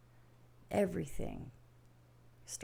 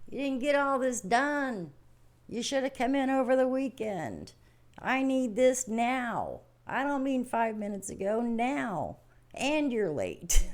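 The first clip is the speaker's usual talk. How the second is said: higher, louder nagging boss; demanding boss; 6 semitones higher, 4 dB louder than usual. Retold or imitated. Boss running at the worker, higher and louder demanding. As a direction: play the boss who wants it now. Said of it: "your boss comes running at you and saying".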